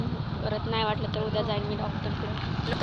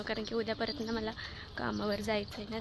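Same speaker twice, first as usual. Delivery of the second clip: ordinary delivery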